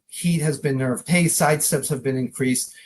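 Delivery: ordinary delivery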